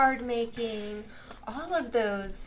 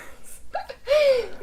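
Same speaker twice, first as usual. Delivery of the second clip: loud, high-pitched burst of laughter; real squealing laugh, high and loud; 5 dB louder than usual. Real, not acted. Host amused by the backwards-camera mix-up, high laugh.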